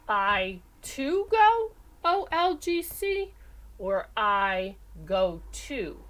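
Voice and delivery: slow, drawn out options; teacher weighing options slowly; slow choices. Shown as a demonstration. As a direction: lay out each choice slowly. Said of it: "What do you think?".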